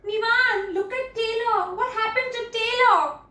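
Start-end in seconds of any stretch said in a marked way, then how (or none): none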